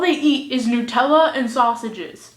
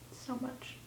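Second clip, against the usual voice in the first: faint, voiceless whisper; very soft, breath-only repeat; 2 semitones higher, 18 dB quieter than usual. Pretend. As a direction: whisper it as a lingering echo. Repeats her love declaration in whisper.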